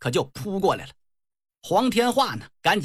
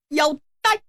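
high, drawn call to the enemy; battle challenge; 8 semitones higher than usual. Acted. Young warrior hails the four enemy generals, a high challenging call.

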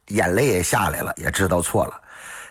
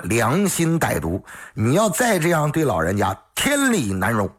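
doctor's righteous tirade, pitched higher; stern scolding; 8 semitones higher than usual. Acted. Doctor scolding the scheming daughter-in-law, stern and raised. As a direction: lecture her like a wrathful elder.

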